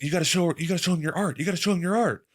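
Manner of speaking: high eager mom pressing her daughter; mom urging. Retold or imitated. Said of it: "was like".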